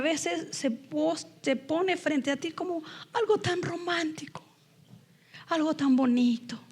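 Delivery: whispered, confiding lesson; whispered warning about temptation; hushed. Real, not acted. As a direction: draw them close with a hush.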